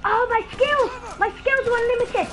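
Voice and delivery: high, loud gloating cry; boastful shout. Real, not acted. Gamer gloats to viewers about his skills, high and loud. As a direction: crow over the kill.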